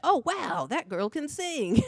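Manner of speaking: high, gushing mock amazement; amazed listener. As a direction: imitate an awestruck admirer. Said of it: "it's like".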